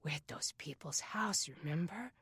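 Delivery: very soft, calming whisper; gentle whisper. A narrator's reading. Aaron reminding his crying sister where they are, whispered gently. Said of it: "His voice was a gentle whisper".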